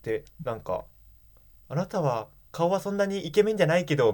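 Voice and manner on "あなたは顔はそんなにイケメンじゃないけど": lightly voiced Suzu. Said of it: "みたいな感じで始まってさ".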